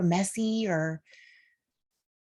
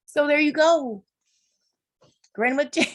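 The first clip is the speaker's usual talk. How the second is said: high, louder, tearful half-laugh; smiling through tears; 7 semitones higher, 4 dB louder than usual. Real, not acted. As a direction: push brightness over real crying. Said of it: "I'm smiling because I don't wanna cry".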